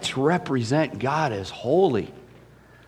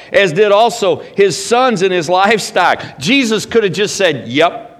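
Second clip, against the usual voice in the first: loud, high scripture reading with driving emphasis; full-force preaching; 5 semitones higher, 5 dB louder than usual. Acted. Preacher reads the woman at the well to the church, in full-force preaching. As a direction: proclaim the passage loudly to the congregation.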